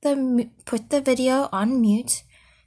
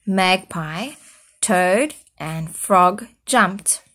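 plain reading voice

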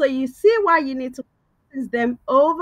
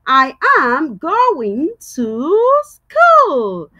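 slow model sentence; 4 dB louder, much slower than usual. Shown as a demonstration. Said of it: "let's use that in a sentence".

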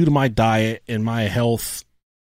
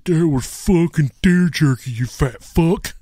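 a hint of the brother, slowed down; 4 semitones higher, slower than usual. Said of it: "He said".

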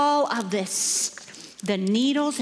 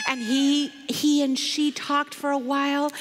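usual speaking voice

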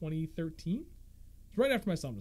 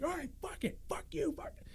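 much higher, squeaky mock tantrum; mock swearing neighbor; 11 semitones higher, 9 dB quieter than usual. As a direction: imitate a petty fit of rage. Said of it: "And he's like".

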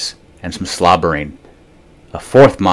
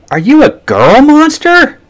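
much higher and louder, slow disbelief; shocked question; 8 dB louder, slower than usual. A narrator's reading. The boy spots the bow, much higher in disbelief. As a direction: squeak it out in shock.

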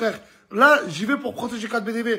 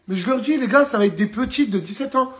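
normal talking voice

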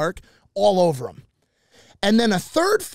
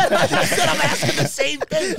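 laughing; 10 semitones higher than usual. Real, not acted. Laughing reply.

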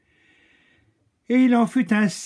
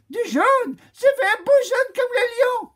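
put-on very high, loud childish demand; greedy demand; 4 dB louder than usual. Lady Chameleon demands the lion's yellow from the oak, very high. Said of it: "s'écria".

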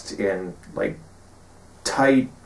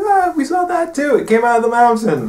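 much higher, louder and faster cheerful blabbing; eager blurting; 14 semitones higher, 5 dB louder than usual. Retold or imitated. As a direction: blurt it out happily, no filter. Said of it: "It's like".

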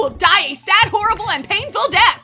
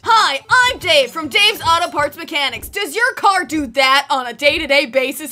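lower, salesman commercial voice; cheesy ad read; 4 semitones lower than usual. Acted. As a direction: pitch the auto shop like a local TV ad. Fake car-parts commercial.

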